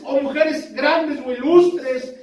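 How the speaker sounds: high, urgent pulpit exhortation; fervent preaching. Real, not acted. Preacher urges the brothers to act on the heart's prompting, fervent preaching. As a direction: preach it high and driving, stretching the last words.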